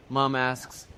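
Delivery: ordinary delivery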